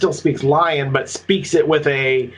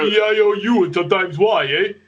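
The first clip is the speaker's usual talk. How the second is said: higher-pitched mock tough guy reciting; Sylvester Stallone; 5 semitones higher than usual. Friend riffing on prisoners' speech, Stallone-like tough-guy voice. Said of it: "coming out more like Sylvester Stallone".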